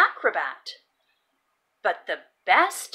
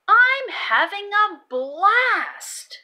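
excited; 9 semitones higher, 5 dB louder, slower than usual. Pretend. Max voiced, joyful line.

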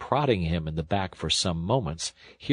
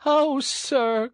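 high-pitched theatrical wail; tearful cry; 4 dB louder than usual. A narrator's reading. Toad as washerwoman to engine driver, tearful wail. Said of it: "crying afresh".

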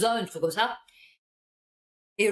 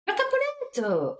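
high, slow cry of recognition; surprised recognition; 14 semitones higher, slower than usual. Real, not acted. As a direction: lights up at a familiar name. Recognises her old neighbourhood. (s'exclame, surprise de reconnaître son quartier)

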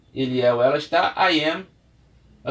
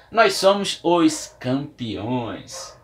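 slow, deliberate; much slower than usual. Shown as a demonstration. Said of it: "a gente não fala nós estamos".

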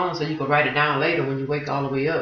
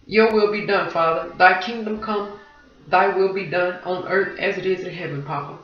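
prayer; 2 semitones higher than usual. Real, not acted. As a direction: pray it from the heart.

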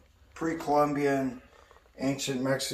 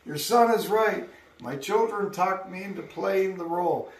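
put-on German accent; 6 semitones higher, 4 dB louder than usual. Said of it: "in his German accent".

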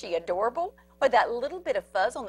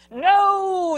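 loud, high shout; the whole crowd cries out; crowd yells; 8 semitones higher, 8 dB louder than usual. Acted. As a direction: yell no in alarm. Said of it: "the crowd yelled".

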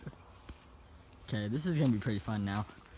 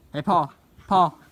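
louder calling a name; 5 semitones higher, 10 dB louder, slower than usual. Real, not acted. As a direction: call across the room. Calling out to Paul.

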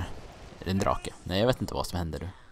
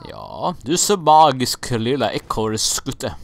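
doting praise; 4 semitones higher, 8 dB louder, slower than usual. Acted. Gamer praises squirrel character.

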